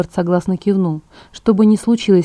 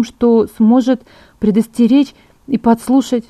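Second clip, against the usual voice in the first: higher, louder, animated scheming; lightly voiced Bella, eager; 5 semitones higher, 6 dB louder than usual. A narrator's reading. Bella proposes tricking James, eager and persuasive. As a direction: pitch the plan with growing conviction.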